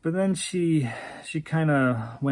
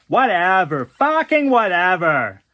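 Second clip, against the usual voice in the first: loud, angry dismissal; yelled; 3 semitones higher, 8 dB louder than usual. Acted. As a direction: yell it and storm off. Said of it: "He yelled".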